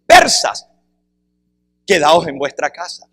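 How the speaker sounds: "Persas" called high and loud, then "quedaos en vuestra casa" as a firm order; calling out to Persians. Shown as a demonstration. Preacher addressing the Persians themselves, loud call. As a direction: hail them by name, then give the order. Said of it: "la otra manera de entenderlo es".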